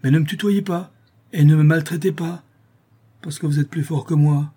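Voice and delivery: high, offended complaint; indignant protest. A narrator's reading. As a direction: protest like a bully who got hurt. Bailiff protests rough treatment.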